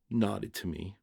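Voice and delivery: plain reading voice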